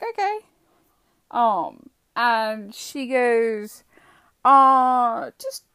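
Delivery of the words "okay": high, questioning acknowledgement; uncertain agreement. Retold or imitated. Driver answering the police officer, uncertain. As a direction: answer with a puzzled upward lift. Retold wary reply to officer.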